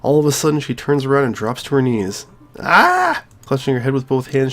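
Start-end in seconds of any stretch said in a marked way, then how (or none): 2.6-3.2 put-on high piercing girl's scream. Sayori breaking down before her friend, screaming. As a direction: let out an anguished scream. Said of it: "she screams as loudly as she can".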